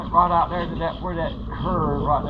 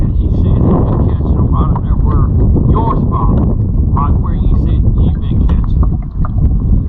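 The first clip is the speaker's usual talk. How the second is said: 1 calling out; 2 semitones higher, 11 dB louder than usual. Real, not acted. Calling news across the water.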